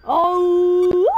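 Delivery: long, loud high "oh"; panicked wail. Real, not acted. Player sure they will die, wailing loudly.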